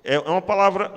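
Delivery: ordinary delivery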